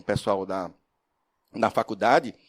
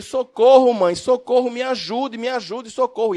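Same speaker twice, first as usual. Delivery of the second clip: much higher, louder mock cry of a frightened child; child crying for help; 10 semitones higher, 6 dB louder than usual. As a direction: imitate the blind boy calling his mother in panic. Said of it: "E ele". (imitando o grito desesperado de uma criança)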